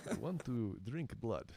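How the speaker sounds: lightly hammed vampire accent with a Russian tinge; a hint of a vampire, played for laughs. Shown as a demonstration. To friends on a podcast, a light mock vampire accent. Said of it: "in a vampire accent".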